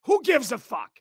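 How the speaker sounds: normal talking voice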